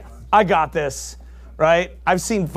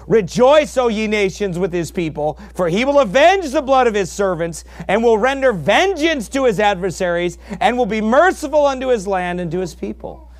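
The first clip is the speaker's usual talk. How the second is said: thundering scripture reading; 5 semitones higher, 4 dB louder than usual. Acted. Preacher reading God's threats to Israel at full force. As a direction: boom out God's vow of vengeance.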